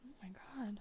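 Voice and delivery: breathy whisper. Real, not acted. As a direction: whisper in dismay.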